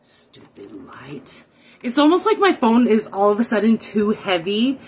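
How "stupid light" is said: slow, low whispered curse; low whispered grumble. Real, not acted. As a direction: mutter it under your breath, very slowly. Muttering curse at the light.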